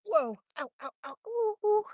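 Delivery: quick ows climbing high into oohs; pained yelps. Real, not acted. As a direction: yelp in sudden pain. Sudden pain while outside.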